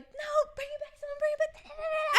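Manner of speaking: high, tearful, half-laughing wail; sobbing plea. Retold or imitated. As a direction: beg through sobs. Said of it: "She's sobbing".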